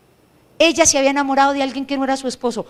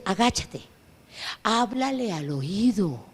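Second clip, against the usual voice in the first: softer and slow, a gentle inner voice; soft instruction; 4 semitones lower, 8 dB quieter, slower than usual. Retold or imitated. The Lord quietly guiding the preacher, soft and slow. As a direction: say it low and slow like a private instruction. The Lord tells him to whisper.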